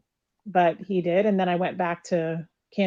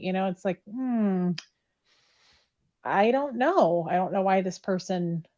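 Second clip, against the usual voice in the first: ordinary talk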